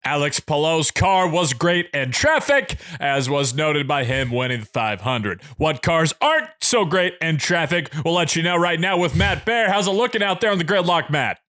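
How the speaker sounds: radio host punching odd words; Rake. Retold or imitated. Host segueing from race winner to traffic report, heavily stressed radio delivery. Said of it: "emphasis on the different words".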